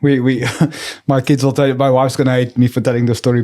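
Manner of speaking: ordinary talk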